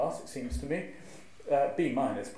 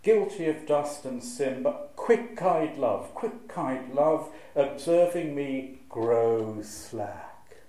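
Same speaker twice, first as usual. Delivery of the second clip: slow, stretched words, a bit louder; elongated reading; 5 dB louder, slower than usual. Shown as a demonstration. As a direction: draw out each word of the poem. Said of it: "you can just hear the, the elongations".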